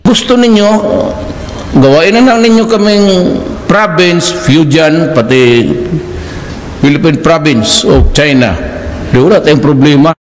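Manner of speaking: loud, mocking, fast proposal; loud sarcastic jab at China; sarcastic loud offer. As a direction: throw it at them with biting sarcasm. Sarcastic offer to become province.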